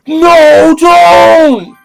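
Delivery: very high, loud wailing no; mock cry. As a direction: plead in a hammed-up high shout. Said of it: "Napoleon's like".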